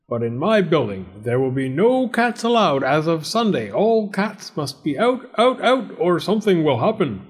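high storybook landlord issuing a warning; stern cat-banning landlord. Acted. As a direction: lay down the law, theatrically.

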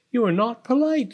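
high-pitched cat character voice; prim little cat, pitched high; affronted pussycat. A narrator's reading. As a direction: reproach her as an offended cat. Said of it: "said Prue".

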